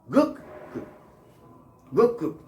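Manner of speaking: good cook said as "goog cook", the D becoming G; assimilated good cook. Shown as a demonstration. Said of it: "that's called".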